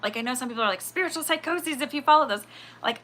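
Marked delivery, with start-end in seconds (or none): 0.8-2.5 high, louder mimicry of naysayers; mock-alarmed, pitched up; mocking critics. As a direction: parody someone scolding spiritual believers. Mimics critics of spiritual readers.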